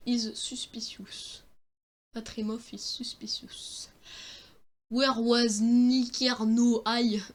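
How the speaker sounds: slow, drawn-out English with a put-on accent; accent anglais. Shown as a demonstration. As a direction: show off your English accent, word by slow word. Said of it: "très bien l'accent anglais". (accent anglais exagéré, très lent)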